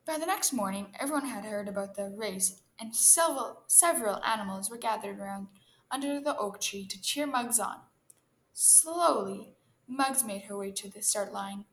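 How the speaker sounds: ordinary delivery, nothing marked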